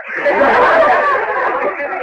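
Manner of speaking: short high burst of laughter; real laugh. Real, not acted. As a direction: laugh out at the joke. Amused at grandson's vanity.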